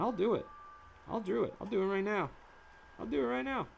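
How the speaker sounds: eager promise. Acted. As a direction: promise it keenly. Eager higher affirmations.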